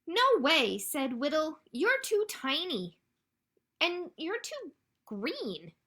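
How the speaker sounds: loud, lively read-aloud with high-pitched animal lines; animated storytime reading. A narrator's reading. Librarian reads ducklings refusing a tiny frog, animated storytime delivery. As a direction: play up each duckling and frog for little listeners.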